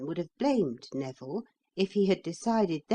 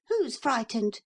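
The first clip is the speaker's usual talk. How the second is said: put-on boy voice; 6 semitones higher than usual. A narrator's reading. Neville's boyish retort.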